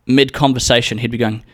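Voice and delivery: normal talking voice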